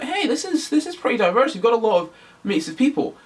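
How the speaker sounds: mock-impressed, pitched well up; pleased viewer reaction. Viewer watching the show and approving, pleased reaction. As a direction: play a viewer's high, approving first reaction.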